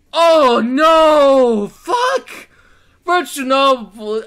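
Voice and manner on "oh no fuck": dismayed outburst. Real, not acted. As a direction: cry out in exasperated dread. Dreads the character's blunder.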